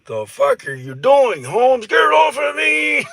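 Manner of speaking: high, loud panicked yelling; shrieking get it off me. Retold or imitated. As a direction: yell in disgust as a bug is shoved at you. Bug shoved in his mouth.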